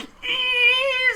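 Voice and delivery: high, held "eee" squeal; imitated scream. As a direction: mimic a screaming character with one long note. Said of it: "he's just screaming like".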